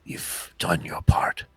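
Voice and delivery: solemn, knowing spirit whispering a warning; whispering orb spirit. Acted. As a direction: breathe it like a secret only they can hear.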